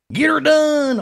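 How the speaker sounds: high, loud hollered catchphrase; Rusty's catchphrase. Retold or imitated. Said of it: "And he was talking like that".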